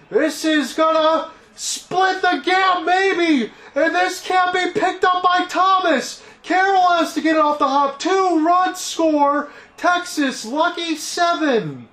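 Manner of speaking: high, loud, excited home-run-style call; live play-by-play. Acted. Announcer calling the ball into the gap for the crowd, high and loud. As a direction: ride the hit all the way around the bases.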